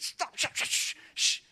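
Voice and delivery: urgent hissed hush; mock-panicked dad hushing a crying child; frantic shushing. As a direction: shush hard and fast to silence a child. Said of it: "And he's crying. Amen. I said".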